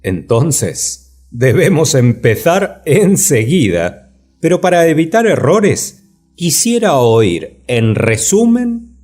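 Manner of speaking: loud, slow, deliberate voice. Acted. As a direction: declaim it loudly and slowly. Second character eagerly asks doctrines.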